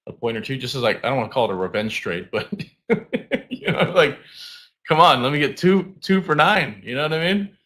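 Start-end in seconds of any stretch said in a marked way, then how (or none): 2.9-3.4 laugh. Real, not acted. Laughs at own revenge trade. 3.5-7.6 excited. Real, not acted. Excited plea for revenge trade.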